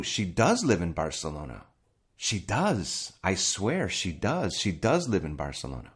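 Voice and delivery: insistent, DOES jumping up in pitch each time; emphatic DOES with a sharp fall after; stress on DOES. Shown as a demonstration. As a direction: swear it's true, punching "does". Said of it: "we'll use the auxiliary verb".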